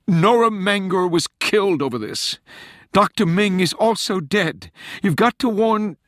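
high, loud, urgent appeal; desperate warning. A narrator's reading. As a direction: break in urgently, pitched very high. Rachel desperately warns of killings.

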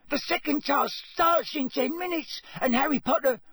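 squeaky, high-pitched house-elf urging; Dobby. A narrator's reading. Elf waking Harry in the library, squeaking urgently. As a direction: squeak it urgently, far above normal pitch. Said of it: "squeaked Dobby".

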